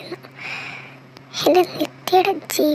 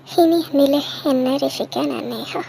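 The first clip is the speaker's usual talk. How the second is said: ordinary talk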